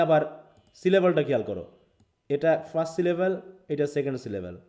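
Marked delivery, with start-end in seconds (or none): none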